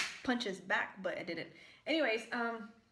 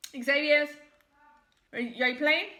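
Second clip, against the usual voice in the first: calling out; 3 semitones higher, 8 dB louder than usual. Real, not acted. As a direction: call him from the other room, louder. Calling out to son in another room.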